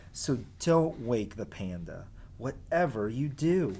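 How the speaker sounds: lively sing-song storybook reading; reading to children. Acted. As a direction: read the rhymes as if to a class of kids. Reads rhyming picture book aloud.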